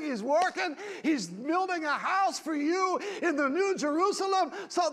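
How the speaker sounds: loud, high shouted preaching; fiery preaching. Acted. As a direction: preach it out with fire. Preaching at full force.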